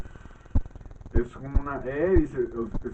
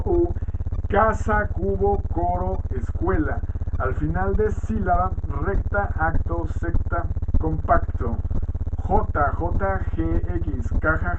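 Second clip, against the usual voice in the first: louder, deliberate word-by-word read-out; dictation-style list reading; 3 semitones higher, 5 dB louder, slower than usual. Acted. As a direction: read out each letter and example like a teacher dictating. Reads letters and example words aloud. (lectura de lista en voz alta, como dictado)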